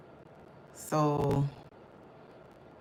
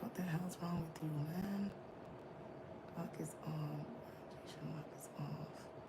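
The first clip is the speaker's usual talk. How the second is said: much softer frustrated whisper; whispered frustration; 17 dB quieter than usual. Real, not acted. Streamer fighting phone settings, frustrated whisper.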